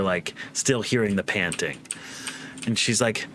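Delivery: ordinary talk, nothing marked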